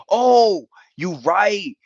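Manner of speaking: ordinary talk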